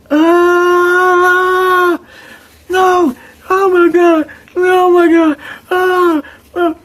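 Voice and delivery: high, drawn-out anguished cries; imitated wailing. Retelling the dude's cries, high anguished wailing. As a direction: wail like a man in pain. Voicing a victim crying out.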